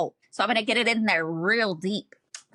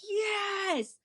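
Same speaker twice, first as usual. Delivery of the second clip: very high, drawn-out squeal; high, stretched-out yes of approval; gleeful cheer; 13 semitones higher, 5 dB quieter than usual. Retold or imitated. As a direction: squeal it softly in mock triumph. Acts out her delight at him.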